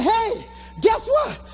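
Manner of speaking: high-pitched, thrilled announcement; excited bragging. Retold or imitated. Man phoning his girlfriend after an ovation, excited.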